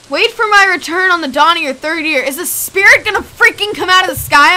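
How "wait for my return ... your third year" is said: loud, raised-pitch incredulous repeat; agitated, higher and louder quoting; loud disbelief. Real, not acted. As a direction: throw the message back in alarm.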